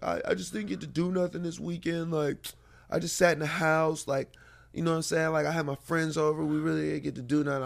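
a hint of a let-down son; imitating his son's whine. Son complaining, retold by dad.

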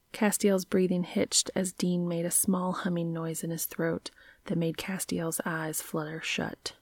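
ordinary delivery

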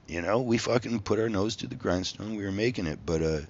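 usual speaking voice

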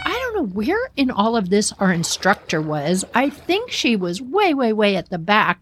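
ordinary talk

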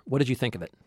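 ordinary talk

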